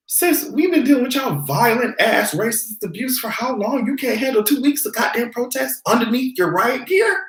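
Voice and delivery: high, sassy scolding rant. Retold or imitated. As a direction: read them like a fed-up sister.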